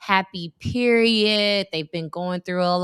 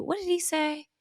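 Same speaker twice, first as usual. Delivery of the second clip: ordinary talk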